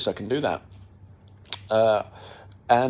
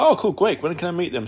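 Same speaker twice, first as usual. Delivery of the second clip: eager reply; 7 semitones higher than usual. Retold or imitated. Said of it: "And I was like".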